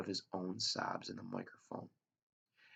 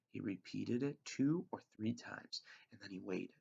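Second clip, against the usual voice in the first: ordinary delivery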